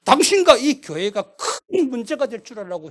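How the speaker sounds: police threatening the pastor; threatening demand. Retold or imitated. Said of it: "목사님 협박합니다".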